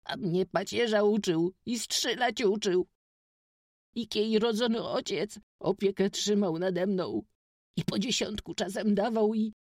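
put-on high boy voice, welling up with tears; bursting into tears. A narrator's reading. Witek remembers Kuba teaching him, near tears. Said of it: "wybuchnął płaczem na przypomnienie".

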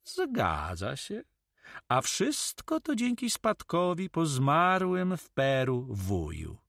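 slow, swooping, jesting answer; amused reply. Acted. Pirate fools the young man about an inheritance, amused. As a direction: draw it out as a joke, rising high then dropping. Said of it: "odparł pirat ze śmiechem".

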